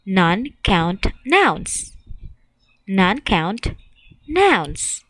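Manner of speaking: "Non-count nouns" said slowly, every syllable drawn out; slow choral repeat. Shown as a demonstration. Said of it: "Say that again with me".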